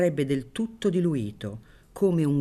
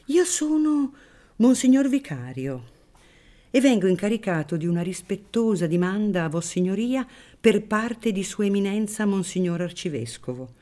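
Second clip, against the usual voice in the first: unctuous priest voice; 6 semitones higher, 3 dB louder than usual. Acted. Unctuous priest introduces himself.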